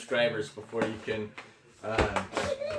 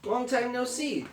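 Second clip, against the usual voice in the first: friendly hare; 10 semitones higher than usual. A narrator's reading. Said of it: "says Hare".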